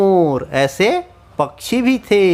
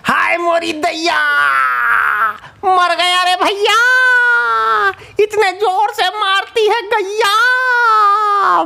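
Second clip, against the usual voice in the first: high, wailing cry of pain; hammed-up high wail of a hurt mosquito; 14 semitones higher than usual. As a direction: shriek it like you've just been swatted. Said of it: "तो मच्छर जोर से चिल्लाया".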